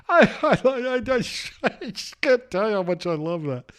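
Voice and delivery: high, squealing through laughter; laughing. Real, not acted. Host delighted by Laughing Larry, laughing too hard to talk. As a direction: barely get it out for laughing. Cracking up at a caller.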